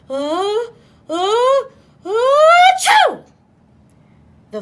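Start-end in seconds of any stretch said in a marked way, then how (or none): 0.1-3.2 huge rising ah-ah-achoo; enormous sneeze. A narrator's reading. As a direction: build up and explode into a sneeze. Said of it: "gave an enormous sneeze".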